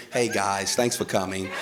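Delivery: composed, polite, carefully spoken mock formality; police voice. Husband explaining to arriving police, put-on composed police voice. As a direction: talk like a reasonable citizen addressing officers. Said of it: "I put on my police voice".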